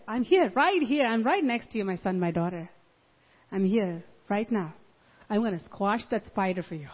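soft, low comforting voice; tender reassurance. Retold or imitated. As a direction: comfort a frightened child gently. Lord comforting believer.

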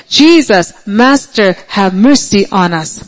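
ordinary delivery

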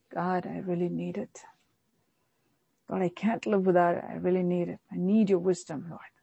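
soft, hushed plea to God; humble prayer. Retold or imitated. Believer humbly telling God of their need, softly. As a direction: pray it quietly and humbly. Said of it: "the humility to go and say".

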